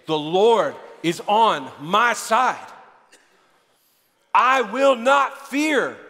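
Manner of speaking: very slow, building proclamation; emphatic declaration. Shown as a demonstration. Preacher urging the congregation to say it again, slow and building. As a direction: draw out each word, building in force.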